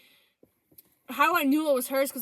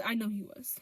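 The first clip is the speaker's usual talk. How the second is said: whisper; 3 semitones lower, 6 dB quieter than usual. Real, not acted. Private certainty about her ex.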